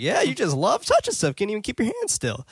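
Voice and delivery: high-pitched teasing imitation; playfully scold, acting out her quip; joking. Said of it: "butts in and jokes".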